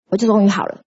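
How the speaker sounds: ordinary talk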